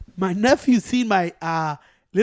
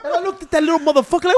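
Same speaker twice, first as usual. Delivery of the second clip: excited fast build; 8 semitones higher, 5 dB louder, faster than usual. Real, not acted. Laughing while telling.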